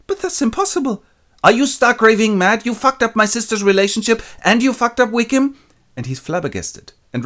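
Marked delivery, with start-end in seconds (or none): 0.1-5.5 high, loud outraged rant; furious refusal. Retold or imitated. Lizzie berating Darcy, high and loud. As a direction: tear into him.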